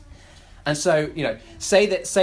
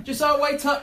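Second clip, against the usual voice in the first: put-on raised high call after a running child; calling ahead; 9 semitones higher than usual. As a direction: shout after him to wait, pitched up. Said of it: "and I look at Josiah".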